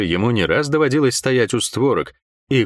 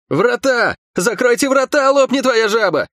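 high, urgent shout; frantic yell at the top of the voice; roaring alarm; 13 semitones higher, 4 dB louder than usual. A narrator's reading. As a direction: roar the order to the whole garrison. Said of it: "взревел опомнившийся Лук".

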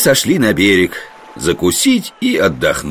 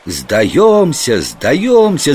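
higher-pitched calling out in surrender; mock cry of surrender; 8 semitones higher, much slower than usual. A narrator's reading. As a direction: call out a pretended surrender, pitch climbing on the repeat. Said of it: "закричали также и гимнаст с панократом". (притворный крик о сдаче)

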